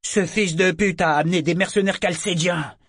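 high, moderately loud outburst; angry roar. A narrator's reading. Lieutenant curses whoever brought mercenaries, roaring. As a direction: roar the accusation, without full volume. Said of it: "rugit-il soudain".

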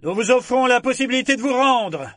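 high, loud hail through cupped hands; shouting across. A narrator's reading. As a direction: call out to the enemy captain. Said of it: "cria-t-il au capitaine". (crié en porte-voix vers l'autre navire)

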